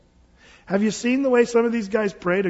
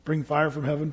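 soft, humble, lower prayer; understated humble plea, softer; calm simple prayer; 4 semitones lower, 5 dB quieter than usual. Retold or imitated. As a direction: pray it quietly and simply. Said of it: "he just cries out to the Lord and says".

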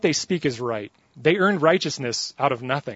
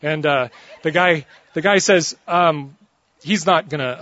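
normal talking voice

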